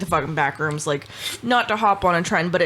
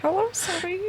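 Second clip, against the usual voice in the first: put-on old lady voice; 12 semitones higher, 6 dB quieter than usual.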